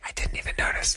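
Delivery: hushed whisper, almost no voice; whispered quote. Retold or imitated. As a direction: whisper it as if embarrassed. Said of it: "she said something like".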